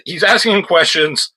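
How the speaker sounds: normal talking voice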